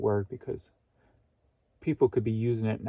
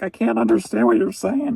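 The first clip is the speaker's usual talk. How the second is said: much higher, louder frustrated shout; exasperated shout; 11 semitones higher, 12 dB louder than usual. Retold or imitated. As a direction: yell at the noisy stomach. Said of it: "I'm like".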